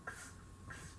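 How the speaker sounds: Ks, ks whispered as a bare ks hiss; voiceless ks, no vowel; ks sound. Shown as a demonstration. Teacher demonstrates the X sound as unvoiced ks. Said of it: "de K S".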